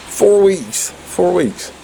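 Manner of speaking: disbelieving high repeat; can't believe it, rising up; incredulous. Real, not acted.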